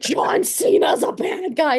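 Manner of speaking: high, loud, drawn-out mock scream; retold screaming. Daughter screaming the news at mom, mock hysterical scream. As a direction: act out hysterical venting, stretching each word. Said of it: "and I'm, like, screaming".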